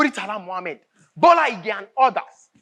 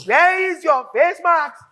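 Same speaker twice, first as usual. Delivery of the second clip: louder, slow, every word spelt out; angry demand; 5 semitones higher, 6 dB louder, slower than usual. Acted. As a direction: bark the question at her slowly. Boss repeats it, louder, slower.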